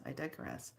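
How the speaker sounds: muttered self-correction; quiet repeat. Shown as a demonstration. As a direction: say "I digress" again under your breath.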